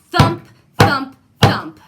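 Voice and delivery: booming thumps. A narrator's reading. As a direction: boom each thump like giant steps. Giant's footsteps approaching.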